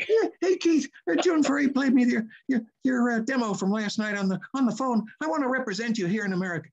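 high, husky little agent voice; Don Pitts. Retold or imitated. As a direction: pitch way up into a funny husky voice. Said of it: "funny, husky little voice like this".

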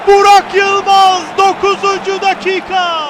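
sudden loud goal shout; screaming football commentator. Real, not acted. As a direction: explode on the goal. Goal call in live commentary.

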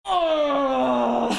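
loud frightened shout; startled yelp. Real, not acted. Player jump-scared in the game, frightened shout. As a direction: jolt out a scared cry.

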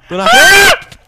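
high triumphant yell; excited shout. Real, not acted. Racing ahead, shouting.

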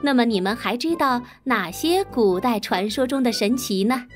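ordinary delivery, nothing marked

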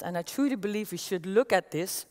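ordinary delivery

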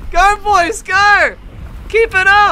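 loud, high cheering; cheering shout. Real, not acted. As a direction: yell encouragement at teammates. Cheering teammates on in fight.

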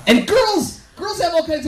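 shouted opener; loud exclaim. Real, not acted. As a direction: burst out loud. Loud call out to crowd.

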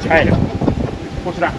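normal talking voice

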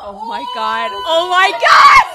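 high, loud shriek through laughter; loud, high, cracking up; laughing outburst. Real, not acted. Reacting to a prank clip.

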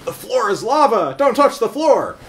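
put-on high, loud kid's game cry; playful shout. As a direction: yell it like a kid playing. Calling out the game.

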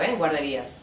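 normal talking voice

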